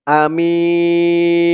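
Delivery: আমিন with the মি vowel held very long, ending on ন; drawn out three alifs then stop; stop with long madd. Shown as a demonstration. Teacher showing the pause form, long held vowel. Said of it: "যদি অক্ফ করি তাহলে উচ্চারণ করতে হবে".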